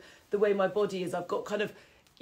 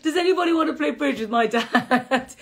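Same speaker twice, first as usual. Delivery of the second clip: louder, higher question bubbling with laughter; laughing; 5 semitones higher, 6 dB louder than usual. Real, not acted. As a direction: ask through a real laugh. Laughing about her dad and bridge.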